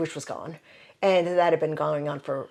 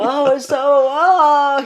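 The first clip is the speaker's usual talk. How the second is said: a hammed-up drama queen wail, louder and higher; mock drama queen; 8 semitones higher, 12 dB louder, much slower than usual. Mocking her past drama queen self to the host, loud and high. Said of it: "was for drama queens".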